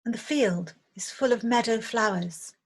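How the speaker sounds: unhurried meditation guide, long pauses between phrases; guided meditation. Acted. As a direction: gently lead listeners through a visualization, leaving space after each phrase. Leads a guided visualization meditation.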